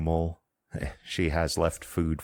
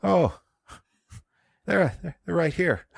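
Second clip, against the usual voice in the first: high, louder surprised granny; old woman surprised; 3 dB louder than usual. Acted. Old woman realizing she's holding the blankets, surprised. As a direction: catch yourself, high and surprised.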